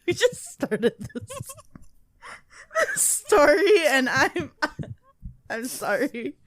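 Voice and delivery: laughing through the words; can't stop giggling. Real, not acted. Breaks into laughter while reading.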